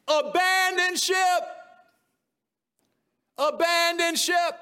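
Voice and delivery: high, slow call of alarm. Pretend. Signs crying out to the king, alarmed call. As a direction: shout the warning abandon ship.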